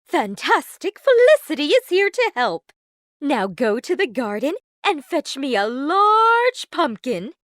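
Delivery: loud, jolly, bossy godmother; brisk fairy godmother. A narrator's reading. Fairy godmother cheering up Cinderella, jolly and brisk. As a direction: take charge cheerfully.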